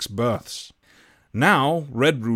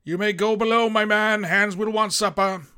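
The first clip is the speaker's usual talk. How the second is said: higher, stiff captain giving orders; captain's command; 9 semitones higher than usual. A narrator's reading. Captain dismisses the cook to the galley, firm. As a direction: send the man below firmly.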